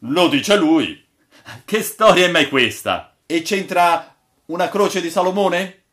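incredulous crew outcry. A narrator's reading. Sailors argue over the old man's claim, loud and incredulous. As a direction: protest as sailors who don't believe it.

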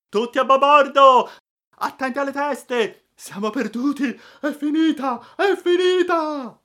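high, panicked shouting; screams of terror. A narrator's reading. Crew and emigrants as the mast falls, terrified screaming. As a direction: scream out in despair as disaster strikes. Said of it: "Urla di terrore echeggiarono".

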